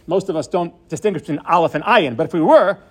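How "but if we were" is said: normal talking voice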